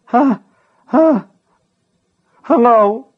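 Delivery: high, loud panicked squeak; shrieky little voice. Acted. Scared boy squeaks into the telephone at Gaunt. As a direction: squeal it in fright. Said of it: "in the same shrieky little voice".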